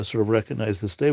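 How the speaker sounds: ordinary delivery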